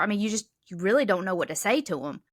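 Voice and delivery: ordinary talk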